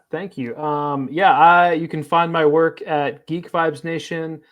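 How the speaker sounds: usual speaking voice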